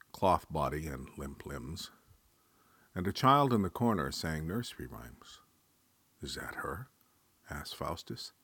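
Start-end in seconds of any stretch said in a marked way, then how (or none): 6.2-6.9 low, whispered question; barely voiced, rising at the end; hushed question. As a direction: ask it under your breath. Faustus sees the child.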